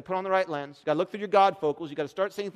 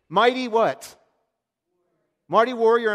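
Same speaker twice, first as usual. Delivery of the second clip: booming, higher proclamation of God's words; proclaiming loudly; 7 semitones higher, 10 dB louder than usual. Acted. Preacher booming God's title over Gideon. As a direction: call it out like God naming him.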